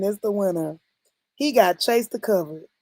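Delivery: ordinary talk, nothing marked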